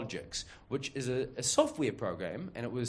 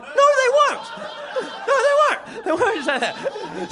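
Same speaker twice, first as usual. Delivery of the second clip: much higher, louder, squealing with laughter; laughing incredulous protest; 11 dB louder, slower than usual. Retold or imitated. Employee protesting the boss's claim, shrieking with laughter. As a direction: squeak it out, cracking up in disbelief.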